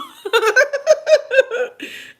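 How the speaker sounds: loud, high-pitched cackle; real high cackling laugh; big laugh. Real, not acted. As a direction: burst out in high, helpless laughter. Laughing at viewer's teasing.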